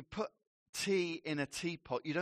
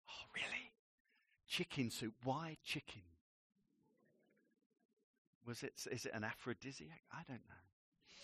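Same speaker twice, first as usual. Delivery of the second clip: soft, quick, half-whispered musing; reading a love letter, mock hushed wonder; 4 semitones lower, 13 dB quieter than usual. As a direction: pretend to puzzle over a love letter in a hush.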